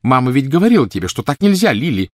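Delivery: high, loud, hurried reproach; scolding child. A narrator's reading. Petunia reminding Lily of mother's rule, scolding. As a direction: tell her off as a bossy sister. Older sister scolds younger in story.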